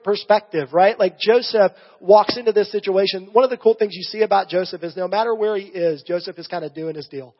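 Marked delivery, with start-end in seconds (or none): none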